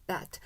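bat with an open vowel and a clipped final t; proper English bat. Shown as a demonstration. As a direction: end bat on the t with no vowel after it. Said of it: "다시 한 번만".

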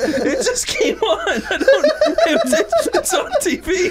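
high-pitched retold plea through laughter; cracking up while acting out a panicked excuse; laughing excuse. Retells helpless excuse, cracking up.

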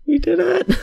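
very high, loud cheer; triumphant shout. Real, not acted. Celebrating the rescue with viewers, very high loud cheer. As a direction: shout the win to the sky.

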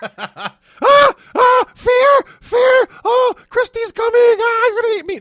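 very high, loud panicked shrieks; hammed-up terror, screaming as if about to be eaten; mock freak-out. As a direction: play it wildly terrified, way up high. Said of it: "Just, just freaked out".

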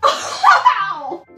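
sharp cry of pain; loud pained yelp. Real, not acted. Clothespin pain.

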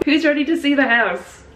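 hyped-up question to the room; excited host. Acted. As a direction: call out like a show host. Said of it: "I said".

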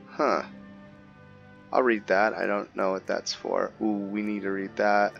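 usual speaking voice

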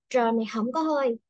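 ordinary delivery

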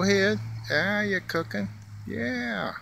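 high, sing-song calling to a squirrel; coaxing pet talk. Real, not acted. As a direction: coax the little animal over, pitch way up. Cheering the squirrel on.

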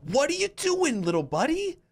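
very high-pitched, baffled questioning; incredulous. Real, not acted. Gamer questioning another player's move, incredulous. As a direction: squeal it in high disbelief.

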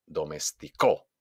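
low "domesticó", punched on CÓ; stress on final syllable. Shown as a demonstration. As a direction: land on CÓ in "domesticó". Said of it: "hago un golpe de voz". ("domesticó" con golpe de voz en CÓ)

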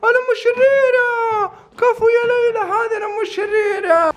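high wailing mock fright; mock terror. Mock terror at evil mother.

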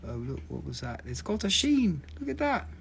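usual speaking voice

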